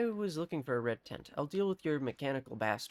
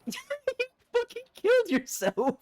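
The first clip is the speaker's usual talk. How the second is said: high, loud real laughing disbelief; 7 dB louder than usual. Real, not acted. Player watches enemy kill itself, laughing hard. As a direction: burst out laughing and squeal the words.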